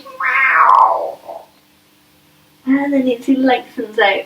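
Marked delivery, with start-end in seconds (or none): 0.0-1.2 mock cat meow, a bit cross; cat noise; little angry meow. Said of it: "He goes".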